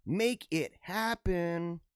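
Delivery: very slow, each word stretched; drawn-out urging. Acted. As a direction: stretch out HAPPEN for mock-dramatic effect. Repeats it drawn out for effect.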